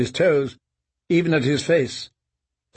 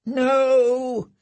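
high, broken mumble; frightened mumble; 11 semitones higher than usual. Acted. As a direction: moan it out in fear. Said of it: "he mumbled".

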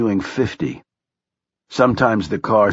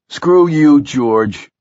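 louder, higher, then dropping on the name; defiant outburst; 5 semitones higher, 5 dB louder, much slower than usual. A narrator's reading. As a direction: throw it angrily at an absent partner. Blaze defies his absent partner aloud.

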